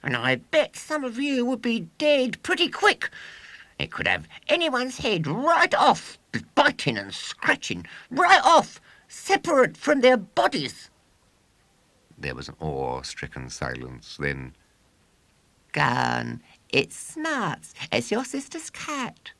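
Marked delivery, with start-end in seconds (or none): 0.0-10.9 put-on high, loud boastful showman boy. A narrator's reading. William boasting to audience. 15.7-19.3 put-on high scornful kid voice; scoffing child. A narrator's reading.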